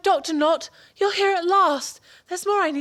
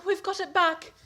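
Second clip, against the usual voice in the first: ordinary talk, nothing marked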